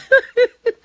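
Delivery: loud high burst of laughter. Real, not acted. Laughing at own joke.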